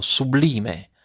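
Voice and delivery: ordinary delivery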